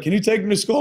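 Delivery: a hint of a small kid asking dad. Dad mimicking his kid asking for a ride. Kid's request voiced by dad.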